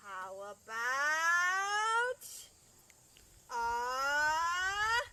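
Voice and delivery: slow, drawn-out how about then a high cat; suspense reveal. Shown as a demonstration. Teacher teases children before revealing the cat. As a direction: stretch it out then pop the answer. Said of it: "teacher builds suspense for reveal".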